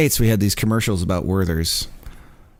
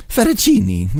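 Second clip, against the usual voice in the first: much higher, hammed-up Italian fettuccine; mock Italian accent; 10 semitones higher, 5 dB louder, slower than usual. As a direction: toss out fettuccine like a cartoon Italian. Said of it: "that's all my Italian that I have".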